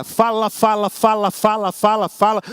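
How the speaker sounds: low, droning mock complainer; grumbling mimicry. Shown as a demonstration. Pastor illustrating the chronic complainer, low droning mimicry. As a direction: drone it out like a tired grumbler. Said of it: "ele não para".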